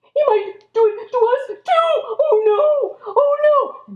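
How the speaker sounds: high, hammed-up panicked wail; worried. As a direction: cry out in mock dread. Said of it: "his friends were worried".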